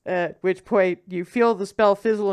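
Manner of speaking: ordinary talk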